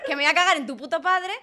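hammed-up indignant swearing; mock angry cursing. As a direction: hurl the insult you never said. Acting the angry reply she withheld.

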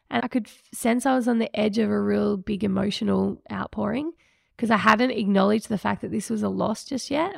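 ordinary talk

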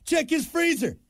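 high sneering heckler voice; mocking heckler. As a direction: sneer a dark joke. Heckler voice dark joke.

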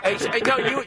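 ordinary talk, nothing marked

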